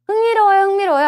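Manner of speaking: high, loud eager exclamation; bright-eyed "흥미로워요" pitched high and loud; eager exclaim. Pretend. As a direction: sell the tempting wrong answer with excitement. Teacher acting out a wrong answer choice.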